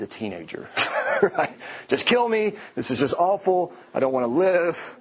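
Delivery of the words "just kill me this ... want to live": higher, hammed-up teenage despair; melodramatic Jonah. Jonah begging God to kill him, hammed-up melodrama. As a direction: overplay the wish to die. Jonah's dramatic death wish.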